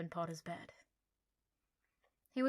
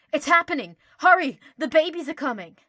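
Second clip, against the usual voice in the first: high, loud, breathless panic; frantic excitement; 8 semitones higher, 19 dB louder than usual. Acted. Harry dragging Draco to see hatching, frantic shout. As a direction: blurt it out in a frantic rush. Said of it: "grabbing Draco's arm".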